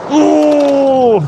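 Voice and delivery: high, loud drawn-out ooh; excited ooh. Real, not acted. Streamer reacting to the race start, excited ooh. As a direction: squeal as the race kicks off.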